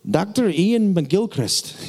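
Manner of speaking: put-on a light Scottish accent, slow and drawn out. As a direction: say the name the way a Scot would, lightly. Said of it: "so that's how he would say it".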